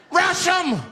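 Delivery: high yelled charge order; screamed rallying cry. Acted. Snapped passenger to the others on the bus, screamed charge. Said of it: "He screamed out".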